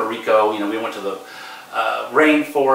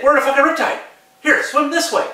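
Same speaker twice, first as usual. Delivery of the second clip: much higher-pitched reenacted urgency; urgent command; 13 semitones higher than usual. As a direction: urgently direct your kid out of danger. Urgent order to kid in riptide.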